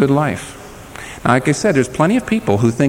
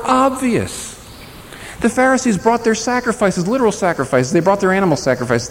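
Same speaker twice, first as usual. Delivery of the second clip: preaching at full force; 7 semitones higher than usual. Acted. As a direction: lift the congregation with weight. Preacher builds on Pharisees.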